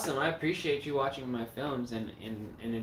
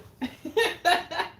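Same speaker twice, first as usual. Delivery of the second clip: high laugh; 7 dB louder than usual. Real, not acted. Laughing at Troll 2 scene.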